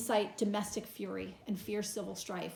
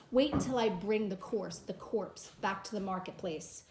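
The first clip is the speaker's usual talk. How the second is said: ordinary delivery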